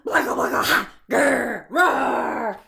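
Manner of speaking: loud growling monster roar; blacka, grr, roar at full blast. Acted. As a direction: give it all you've got like a monster. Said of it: "the monster gave it all he had".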